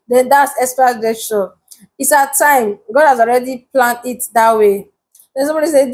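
normal talking voice

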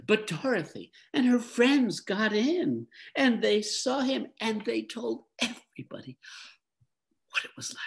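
put-on high, eager teenage boy. A narrator's reading. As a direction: voice young Lear, breathless and high. Boy Lear finishes the news.

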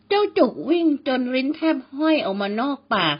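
ordinary delivery

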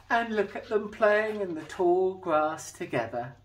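put-on lively read-aloud for children; storybook reading. A narrator's reading. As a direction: tell it like a bedtime picture book. Reading a picture book aloud.

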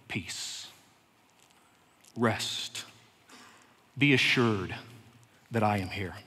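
hushed reassuring voice of Christ; tender whisper. Acted. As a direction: soothe a frightened listener. Christ speaking to the worried.

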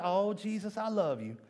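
usual speaking voice

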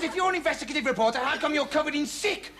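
fast, high-pitched challenge; scornful park keeper. Acted.

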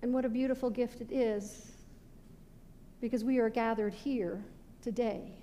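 ordinary talk

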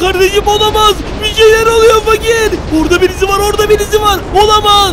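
terrified gamer shrieking to his friend; terrified shout. Real, not acted. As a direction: scream that someone's there. Someone appears, terrified shouting.

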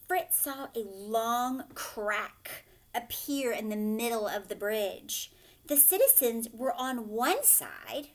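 usual speaking voice

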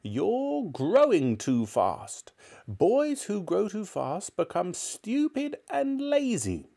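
high, slow, drawn-out scolding; nasty grandmother. A narrator's reading. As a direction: lecture the boy as a mean old granny. Grandmother lecturing George.

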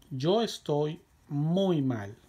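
drawn out for learners; slow and clear for the class; slow model reading. Shown as a demonstration. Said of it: "teacher models the answer".

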